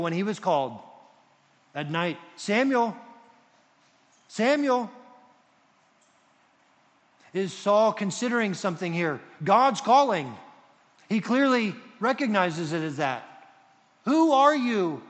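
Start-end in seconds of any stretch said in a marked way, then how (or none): 2.4-4.9 high, loud name call. Retold or imitated. God calls the boy Samuel at night, calling out. 14.0-15.0 loud, high, urgent question; startled cry. Retold or imitated. Saul answers the voice from heaven, startled cry.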